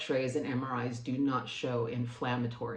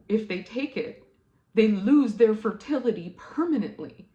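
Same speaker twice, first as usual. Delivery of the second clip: higher and louder, a burst of alarm; upset alarm; 7 semitones higher, 7 dB louder than usual. Real, not acted. To the viewers, stunned at the chemo drug, upset and alarmed. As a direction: let the shock spill over.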